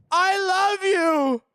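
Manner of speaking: high, slow and loud; mock-dramatic high love cry; exaggerated declaration. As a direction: belt it out dramatically. Over-the-top declaration.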